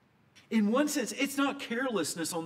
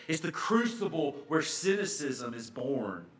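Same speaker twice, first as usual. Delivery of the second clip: normal talking voice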